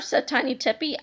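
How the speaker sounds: ordinary delivery, nothing marked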